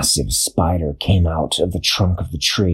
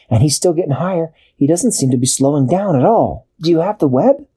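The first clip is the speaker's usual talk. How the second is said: higher, quick urgent Dashi; 6 semitones higher than usual. A narrator's reading. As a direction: hurry it as worried Dashi.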